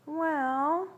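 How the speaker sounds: high, drawn-out hesitation; coy reluctance. A narrator's reading. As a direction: stretch it out as if needing persuasion. Girl feigns reluctance to tease boys.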